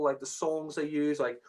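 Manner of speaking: ordinary delivery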